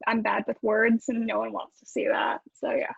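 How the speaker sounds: ordinary delivery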